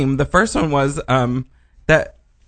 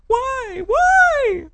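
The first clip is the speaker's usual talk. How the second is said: very high, thin little wail; keen it in a tiny falsetto; mock anguished why. Retelling her creepy dream, in high mock anguish. Recalls thought from nightmare.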